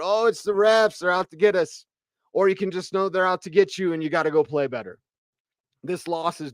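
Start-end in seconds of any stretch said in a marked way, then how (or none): 0.0-1.9 whiny, raised-pitch mock of a fan blaming the refs; mock whining. Host mocking fans who blame the refs, whiny and higher. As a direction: whine it like a sore loser. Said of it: "cry and whine and complain and moan".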